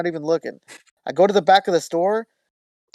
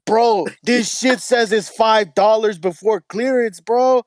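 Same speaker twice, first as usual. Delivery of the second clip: laughing, slower Chicano homie voice; hyped-up Chicano accent, a bit higher; 4 semitones higher, slower than usual. Retold or imitated. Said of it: "Yeah, Mexican".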